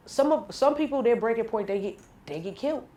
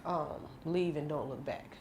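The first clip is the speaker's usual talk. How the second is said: quiet advice; 6 semitones lower, 11 dB quieter than usual. Real, not acted. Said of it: "What would Mo say?".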